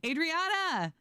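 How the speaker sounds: high, delighted call-out; excited greeting. Real, not acted. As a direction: sing out the name as a hello. Greeting an arriving viewer.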